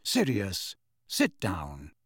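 low, firm, controlled order; sharp. A narrator's reading. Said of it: "said Lupin sharply".

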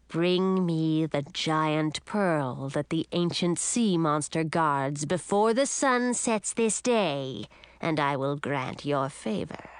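slow menace building to a threat; menacing sea witch. Acted.

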